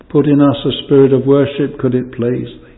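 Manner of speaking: usual speaking voice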